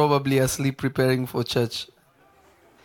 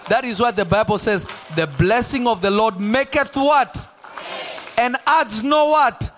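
raised pitch, emphatic pulpit delivery; impassioned preaching; 5 semitones higher than usual. Real, not acted. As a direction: drive each phrase home to the congregation. Preaches at full force.

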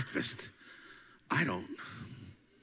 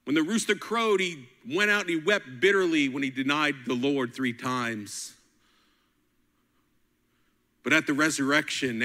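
ordinary talk, nothing marked

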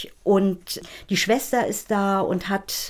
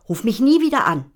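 higher and louder, nie punched; stress on nie; 5 semitones higher, 5 dB louder than usual. A narrator's reading. Child repeats the angry line loudly. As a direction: nie hit hard.

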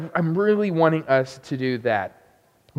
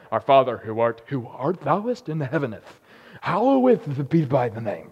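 lower, very slow, pompous archaic intoning; mock King James recital; 3 semitones lower than usual. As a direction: ham up old-fashioned church solemnity. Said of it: "raised like, that's how you pray".

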